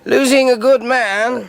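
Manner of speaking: high, loud, pompous boss voice; mocking the head. [name] parroting the head to David, in a high, loud pompous voice. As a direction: send up the head's hollow praise. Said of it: "That came in triplicate too".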